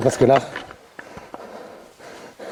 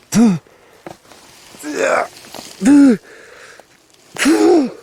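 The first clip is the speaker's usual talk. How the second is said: startled shout; 11 semitones higher, 8 dB louder, much slower than usual. Real, not acted. Counting, startled.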